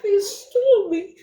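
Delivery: high, sobbing through the words; crying. Real, not acted. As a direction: break down crying. Discovering she was stolen.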